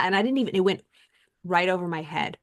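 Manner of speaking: ordinary talk, nothing marked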